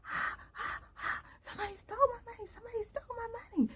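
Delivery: high-pitched imitated wail, rushed; retold screaming. Woman screaming that her money was stolen, imitated screaming. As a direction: imitate her screaming in a squeaky, hurried cry. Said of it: "she was screaming, screaming".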